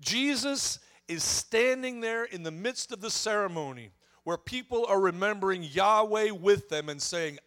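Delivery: usual speaking voice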